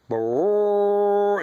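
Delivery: a high, buzzing lip trumpet call, held out; imitated trumpet blast. Man mimicking the rapture trumpet from his dream, buzzing trumpet noise. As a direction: blow it like a trumpet with your lips. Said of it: "I heard that trumpet blow".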